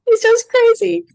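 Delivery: high excited exclamation; amazed. Real, not acted. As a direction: squeal it out, can't believe it. Amazed that clients like her voice.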